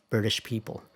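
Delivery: usual speaking voice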